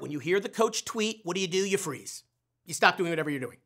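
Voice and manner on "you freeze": ordinary talk, nothing marked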